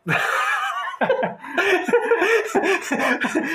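high burst of laughter. Real, not acted. Laughing at accent demo.

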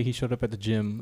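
ordinary talk

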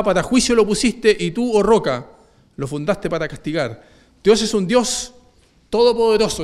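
loud, rising preacher's call to the congregation; full-force preaching. Acted. Preacher to congregation, booming proclamation. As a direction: proclaim it to the whole church.

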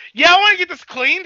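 loud nerd voice. Retold or imitated. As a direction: blurt it way too loud, oblivious. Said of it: "was hella loud".

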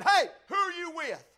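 loud, high barked challenge; rough guard. Acted. Guard in uniform accosting huddled children, rough shout. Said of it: "a rough-speaking guard".

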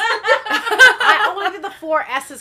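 high laughing joke. Real, not acted. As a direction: speak through the laugh. Joke about four S's.

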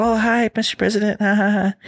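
high, giddy fangirl greeting with a giggle; starstruck fawning fan. Retold or imitated. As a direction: gush at the president like meeting a hero. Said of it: "they're gonna be like".

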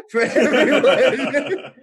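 high, loud, slow laughing repeat. Real, not acted. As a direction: laugh it out, drawn out.

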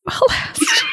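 very high falsetto repeat with a laugh; squeaky high echo. Acted. Echoing the spooky aside.

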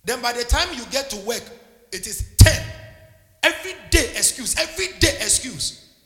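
loud, rising preacher's build; fiery preaching. Acted. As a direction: preach it hard at the congregation. Preaching at full force.

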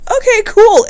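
high, loud easy okay; breezy acceptance. Retold or imitated. Mock suitor accepting the no, high and breezy. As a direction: shrug it off brightly. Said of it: "Then you're like".

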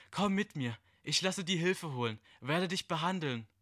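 hohe, schmierige Figurenstimme; ekelhafte Stimme. Acted. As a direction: locke ihn mit falscher, hoher Freundlichkeit. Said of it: "Die ekelhafte Stimme von ihm". (hohe, ekelhaft lockende Stimme)

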